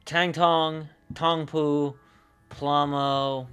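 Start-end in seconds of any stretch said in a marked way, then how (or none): none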